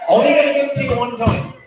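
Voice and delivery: loud preacher shout. Acted. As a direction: bellow it out. Shouting the message from God.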